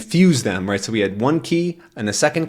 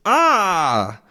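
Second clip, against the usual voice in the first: mock cry of pain; 7 semitones higher than usual. Eggs falling on their heads.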